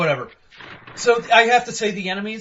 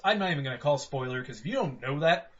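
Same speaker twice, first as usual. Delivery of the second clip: ordinary talk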